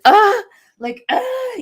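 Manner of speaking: sharp high cries of panic, reliving the attack; panicked gasping cry. Retold or imitated. Reliving her panic attack in church, sharp high yelps. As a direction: let out two sudden high panicked yelps. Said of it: "sorry for the noise".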